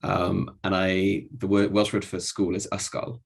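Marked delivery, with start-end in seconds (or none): none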